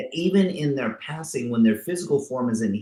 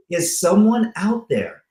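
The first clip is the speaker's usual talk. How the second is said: lightly voiced dead man, pitched higher; a mock call from inside the casket, higher; 8 semitones higher than usual. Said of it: "He goes".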